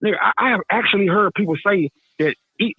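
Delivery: ordinary talk, nothing marked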